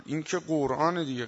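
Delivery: ordinary talk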